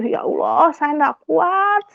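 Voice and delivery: high, drawn-out wail to God; retold cry of despair; tearful plea. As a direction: plead at the end of your strength, stretching the last word. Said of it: "saya hanya bisa menangis".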